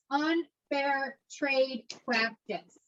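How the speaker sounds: slow, spaced-out UNFAIR TRADE PRACTICE, softly; slow dictation. Shown as a demonstration. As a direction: draw out each word of "Unfair trade practice" for note-takers. Teacher dictates a key term.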